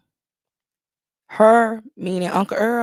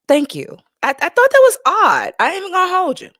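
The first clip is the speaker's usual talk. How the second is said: emphatic; 12 semitones higher, 9 dB louder than usual. Real, not acted. Emphatic reaction to quote.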